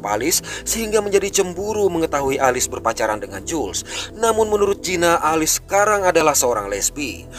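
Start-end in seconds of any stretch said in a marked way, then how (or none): none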